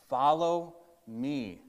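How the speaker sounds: slow, gentle call to follow; Jesus calling. Acted. Jesus calling the tax collector to follow, slow and calm. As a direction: invite him slowly and simply.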